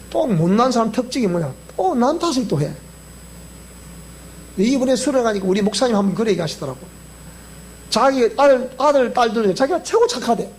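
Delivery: ordinary talk, nothing marked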